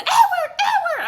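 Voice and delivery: high, loud panicked shout; wife screaming at her husband in terror; frantic screaming name. Retold or imitated. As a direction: scream his name to save us. Mom screaming at dad.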